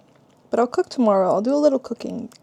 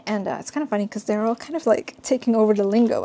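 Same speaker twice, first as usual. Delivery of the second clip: ordinary delivery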